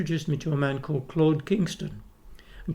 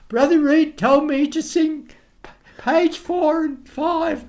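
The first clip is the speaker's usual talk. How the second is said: put-on boyish piping voice; 12 semitones higher, 6 dB louder, slower than usual. Child self quoting instructions.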